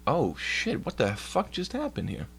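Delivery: high, soft startled exclaim; surprised. Real, not acted. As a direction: caught off guard by the screen. Laptop does something unexpected.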